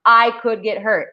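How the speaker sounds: usual speaking voice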